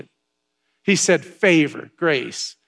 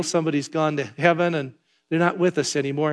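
normal talking voice

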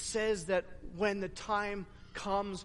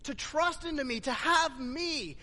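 higher, impassioned sermon delivery; fervent preaching; 7 semitones higher than usual. Acted. Pastor retelling Jesus to congregation, fervent preaching. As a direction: preach the scene out with fire.

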